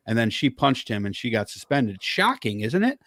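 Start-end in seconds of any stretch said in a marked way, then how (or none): 2.0-3.0 pitched up high in dry sarcasm; mock surprise, raised high; sarcastic. Real, not acted. Sarcastic aside about suspension.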